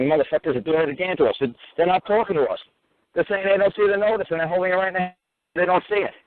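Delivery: imitating the angry crowd, mildly; mock yell. Bali's supporters shout at the judges, a mild mock yell. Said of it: "were all screaming and cursing".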